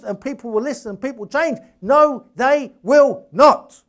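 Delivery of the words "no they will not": slow, louder, every word hit hard; emphatic denial. Real, not acted. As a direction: drive each word home, slowly. Insisting people won't change.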